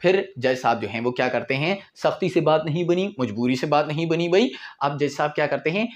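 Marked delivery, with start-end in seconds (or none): none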